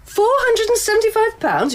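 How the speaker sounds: high, loud gasp at the price; as if the price is outrageous; shocked disbelief. Acted. Customer to bookseller on seeing the price, in loud shocked disbelief.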